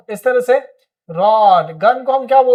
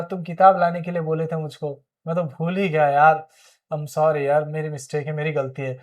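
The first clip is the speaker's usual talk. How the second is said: softer, lower, fading apologetic mumble; sheepish apology; 5 semitones lower, 4 dB quieter than usual. Acted. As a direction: own the mistake meekly, trailing off. Acted apology for forgetting.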